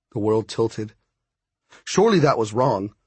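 plain reading voice

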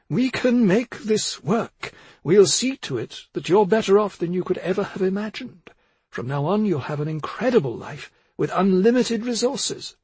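high-pitched, fervent promise; impassioned pitch, voice lifted high throughout; impassioned persuasion. A narrator's reading. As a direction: win him over with impassioned promises. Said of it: "not as impassioned as before".

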